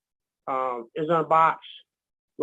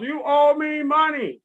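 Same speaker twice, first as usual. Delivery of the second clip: mock yelled demand; 8 semitones higher, 3 dB louder, slower than usual. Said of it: "They yelling down from the crowds".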